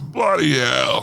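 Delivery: slow, drawn-out British "Bloody hell"; British accent. Retold or imitated. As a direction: drag out "Bloody hell" like a Brit. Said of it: "started out their comment with".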